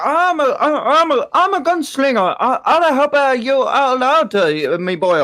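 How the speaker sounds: high, loud hammed-up gunslinger drawl; over-the-top imitation of a grizzled gunslinger; mock gruff cowboy. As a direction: ham up a cowpoke's boast. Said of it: "That ain't really what I sound like to you".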